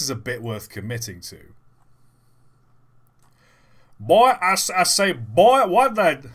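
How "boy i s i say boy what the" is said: high, loud Southern rooster blowhard; Foghorn Leghorn. Acted. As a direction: bluster like a cartoon rooster. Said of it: "my Foghorn Leghorn impression".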